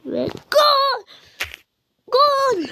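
high excited goal shout; real thrill, goal called out high; goal cheer. Real, not acted. Celebrating a goal.

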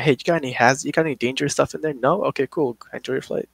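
breezy check-in agent. Retold or imitated. Agent working around the gun question, softly casual. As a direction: breeze through it lightly and wave them on.

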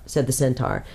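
plain reading voice

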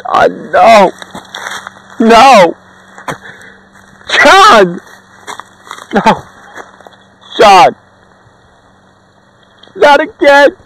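screaming over a dead friend; anguished cries. Acted. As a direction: cry out in despair over the body. Friend has just died.

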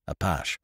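Apache said as French a-PASH, two syllables; French-style Apache, no final ee; Gallicized pronunciation. A narrator's reading. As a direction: give Apache its French sound, ending in sh. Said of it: "gave it its Gallicized pronunciation".

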